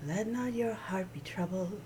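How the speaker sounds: soft whispered comfort; whispering. Real, not acted. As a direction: whisper it gently, like a blessing. Comforting scripture, unvoiced.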